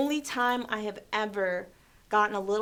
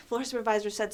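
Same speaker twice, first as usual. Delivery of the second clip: ordinary delivery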